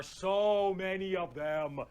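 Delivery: "so many" drawn out long; slow, stretched weight on "so many of them"; stretched emphasis. Real, not acted. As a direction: drag out "so" to show a vast horde. Stressing the size of the horde.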